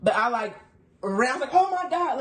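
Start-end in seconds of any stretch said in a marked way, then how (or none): 1.5-2.2 high squeal of shock; shocked gasp. Retold or imitated. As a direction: squeal it out in disbelief. Panicked reaction to proposal.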